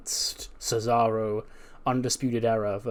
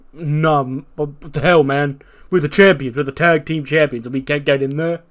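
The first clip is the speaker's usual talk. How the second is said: loud, high, indignant shouting; furious protest; 3 semitones higher, 8 dB louder than usual. Retold or imitated. Jay shouting after being denied entry, furious. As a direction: rage at being locked out. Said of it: "and Jay's like".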